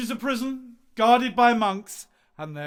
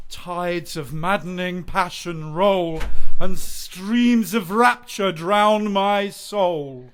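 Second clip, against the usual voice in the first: slow, swelling declamation that grows louder; rapturous recital; 9 dB louder, slower than usual. Acted. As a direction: pour out the lines like a lovesick poet. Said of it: "lines I've been working on".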